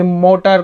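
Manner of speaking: American-English मोटर, flapped t; American accent. Shown as a demonstration. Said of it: "मोटर ऐसे पढ़ते हैं".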